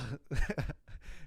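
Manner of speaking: mock Tom Segura chuckle; imitated funny laugh. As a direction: copy his funny laugh. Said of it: "that funny laugh".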